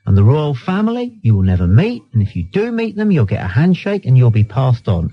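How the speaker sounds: ordinary delivery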